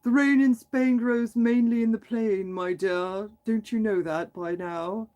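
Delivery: slow, mock-proper English accent; silly British accent. Shown as a demonstration. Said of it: "not a silly, like".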